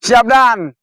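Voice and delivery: loud military reply; shouted salute. Acted. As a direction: bark it out. Recruit answers commander.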